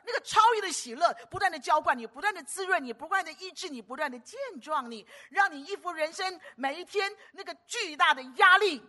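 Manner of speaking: high, loud fervent preaching; preaching at full force. Real, not acted. Preacher urging the congregation about joy: high, loud fervent preaching. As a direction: pour it out like a revival preacher.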